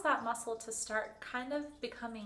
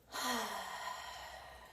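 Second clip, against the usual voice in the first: long, airy sigh out through the mouth; 3 dB quieter than usual. Shown as a demonstration. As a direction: let out a slow cleansing breath. Deep breathing exercise, audible exhale.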